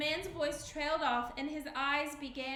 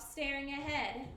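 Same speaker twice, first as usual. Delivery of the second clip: ordinary delivery, nothing marked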